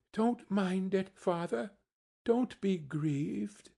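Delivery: put-on high, tender child voice, slowing down; children consoling father. A narrator's reading. Children console their grieving father.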